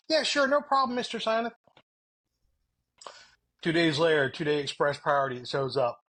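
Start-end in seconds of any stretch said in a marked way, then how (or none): 0.1-1.5 high, eager yes-man reply; a hint of mock clerk politeness; chirpy customer service voice. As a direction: play the cheerful clerk, pitched way up.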